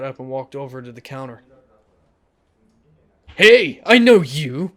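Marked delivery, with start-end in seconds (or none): 3.4-4.7 slow, much louder shout, higher; loud punk challenge. Acted. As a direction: bark it out to pick a fight. Punk confronts narrator at bar.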